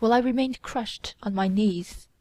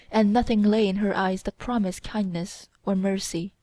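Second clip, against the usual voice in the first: plain reading voice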